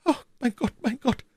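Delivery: high, frightened queenly murmur; terrified murmur. A narrator's reading. Queen hears her secret told, murmuring in terror. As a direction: murmur to God in dread. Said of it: "murmelte die Königin".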